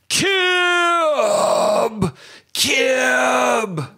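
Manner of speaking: long high drawn-out wail of the name; eerie high call on a single held word; ghostly voicemail cry. Retold or imitated. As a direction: let the one word stretch out high, then drop. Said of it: "Like that".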